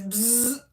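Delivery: buzzing zap noise; bug zapper buzz. Retold or imitated. Reader describing bug zappers to Ilda, buzzing imitation. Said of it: "it zaps 'em, and it says".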